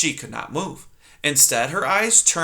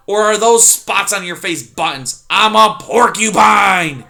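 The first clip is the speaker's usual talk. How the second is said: porcupine shout; 6 semitones higher, 9 dB louder than usual. Acted. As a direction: bellow out who he is. Erith voiced in story.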